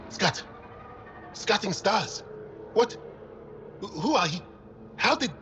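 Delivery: high, startled outburst. Acted. Researcher waking to find an alien stranger, startled. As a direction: stammer out questions in shocked alarm.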